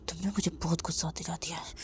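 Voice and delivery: whispered threat; breathless whispered menace; menacing whisper. Acted. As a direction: hiss the threat close.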